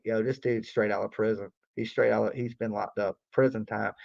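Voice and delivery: low, hushed muttering to himself; muttered aside. Retold or imitated. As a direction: mutter it to yourself, knowingly. Retold inner thought about worker.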